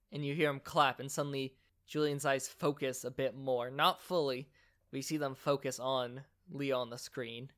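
ordinary delivery

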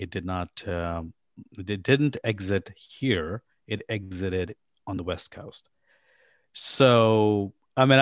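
normal talking voice

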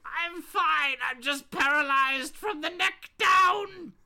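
high, strained villain squawk; strained Joker voice. Acted. Injured villain piping up from the floor, strained squawk.

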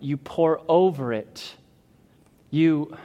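usual speaking voice

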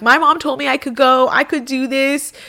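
high, loud mock protest; retold yelling. Yelling at her cousin to let her leave, retold. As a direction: act out yelling back in an argument. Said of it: "I'm just yelling off into space".

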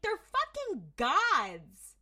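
high, loud, slowly stretched outcry; exasperated protest. Real, not acted. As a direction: drag the words out in loud indignation. Host protests the show's logic.

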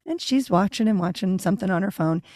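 ordinary delivery

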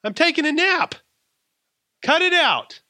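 put-on high, loud whining kid voice; whiny kid complaint. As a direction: whine it like a tired child in the back seat. Quoting complaining kids on road trip.

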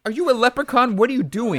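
high-pitched, exasperated disbelief; baffled, hammed-up scolding; mock incredulous. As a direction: demand it in mock outrage, way up high. Mock outrage at bad accent.

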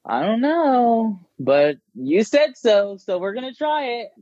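high, drawn-out mock hesitation; reluctant client imitation. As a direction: mimic a scared client reluctantly agreeing, pitched high. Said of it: "you didn't know and you were scared".

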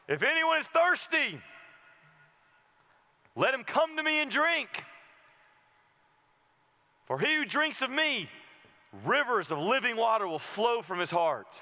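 Jesus crying out. Acted. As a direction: call it out like a prophet to the crowd. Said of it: "Jesus cries out".

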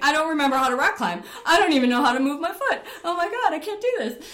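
loud, fast, high mock panic; frozen climber's hammed-up alarm; freaking out. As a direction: babble in re-enacted panic with a laugh in it. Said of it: "like a deer in headlights".

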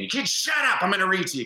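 put-on high, cranky old man bark; hammed-up grouchy geezer; grumpy old man. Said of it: "I'd bring in grumpy old man".

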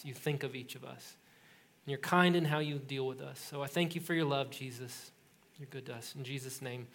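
soft, hushed prayer voice. Real, not acted. Pastor leading the congregation in prayer, hushed and sincere. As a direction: pray quietly and sincerely.

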